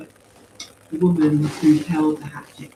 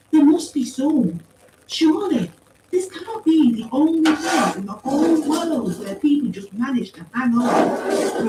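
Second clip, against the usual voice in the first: high, insistent, hopeful argument; earnest pleader; 11 semitones higher, 5 dB louder than usual. A narrator's reading. Paz insists other survivors exist, earnest plea. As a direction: appeal earnestly, slowing at the end.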